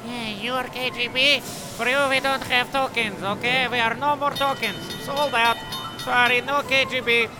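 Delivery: lightly voiced token clerk; unhelpful token seller. Token seller refusing the KGB, lightly acted refusal.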